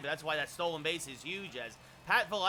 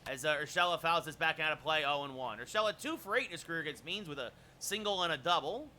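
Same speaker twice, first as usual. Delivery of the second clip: normal talking voice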